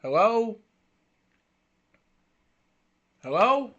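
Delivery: loud, high call of hello. Real, not acted. Frozen camera, calling out to listeners, loud. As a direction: call out to check if anyone hears.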